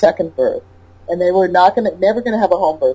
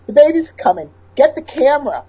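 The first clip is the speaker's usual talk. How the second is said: urgent wife; 8 semitones higher than usual. Retold or imitated. A wife calling out during labour.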